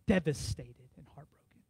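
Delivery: "devastated and heartbroken" breathed out in a whisper; whispered grief. Real, not acted. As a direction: let the words fall to a hushed whisper. Telling friend's grief.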